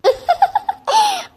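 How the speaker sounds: loud, high cackling laugh; high peals of laughter; mocking laugh. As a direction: crack up at her misery. Laughing at the weeping woman.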